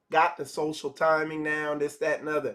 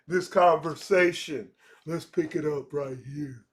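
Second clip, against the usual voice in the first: cheesy pickup line; 3 semitones lower than usual. Retold or imitated. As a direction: deliver the corny punchline smooth and low-key. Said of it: "stupid pickup lines".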